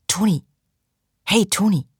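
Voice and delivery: calling out. A narrator's reading. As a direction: call his name to wake him. She tries to rouse him.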